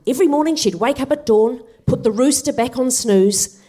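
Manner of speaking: ordinary delivery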